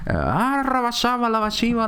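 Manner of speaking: high-pitched fake ecstatic babble; swooping up into mock gibberish; mock speaking in tongues. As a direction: imitate a pseudo-pastor babbling in tongues. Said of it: "empiezan a hablar como".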